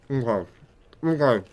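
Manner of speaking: talking with a mouthful of chicken; words round a bite of food; mouth full. Real, not acted. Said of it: "I'm talking about my mouthful".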